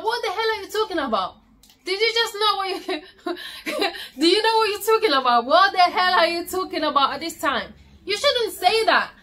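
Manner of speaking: high-pitched mock outrage with a laugh breaking in; fast, high, indignant retelling; retold upset screaming. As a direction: act out yelling at your partner, half laughing. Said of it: "I was screaming".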